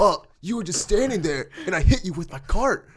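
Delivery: imitated indignant customer, high and loud; mock accusation pitched well up; pissed off. Replaying the customer blaming him for the cart, pissed off. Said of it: "This guy was just pissed".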